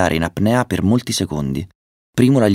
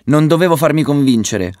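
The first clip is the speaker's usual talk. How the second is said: high, loud regretful yell; shouting over din; 8 semitones higher, 5 dB louder than usual. A narrator's reading. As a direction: shout to be heard over the roar. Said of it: "urlò per farsi sentire sopra il frastuono". (urlo sopra il frastuono)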